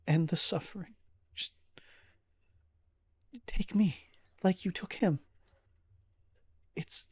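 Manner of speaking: high, halting, desperate begging; grieving plea. Acted. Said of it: "crumples into just a ball of sorrow".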